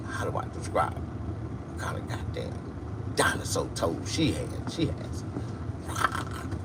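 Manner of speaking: slow, soft whispered aside; hushed whisper. Retold or imitated. Describing her toes, hushed whisper. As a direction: whisper it like it's too awful to say.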